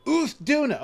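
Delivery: high, loud excited call; excited shout. Real, not acted. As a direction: call the name out gleefully. Spots a target monster.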